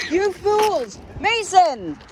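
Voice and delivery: high exasperated yell. Real, not acted. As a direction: yell at them, fed up. Yelling at friends crossing road.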